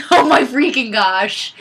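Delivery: ordinary delivery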